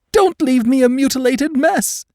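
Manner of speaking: high, loud sobbing plea; pleading. A narrator's reading. Injured woman pleading with her friend, high sobbing plea. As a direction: plead through gasping sobs. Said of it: "she pleaded".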